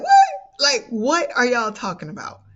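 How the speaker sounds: laughing, high disbelief; surprised disbelief. Real, not acted. Mom asking her kids if they're serious, laughing disbelief. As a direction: laugh it out, can't believe it. Disbelief at being called angel.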